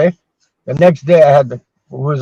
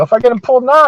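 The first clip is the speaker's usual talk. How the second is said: much higher, disbelieving; incredulous self; 9 semitones higher, 3 dB louder, faster than usual. Retold or imitated. To the dentist about pulling his teeth now, much higher and incredulous. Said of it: "I go".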